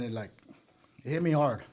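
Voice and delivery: ordinary talk, nothing marked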